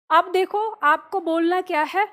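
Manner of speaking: usual speaking voice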